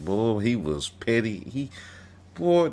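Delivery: normal talking voice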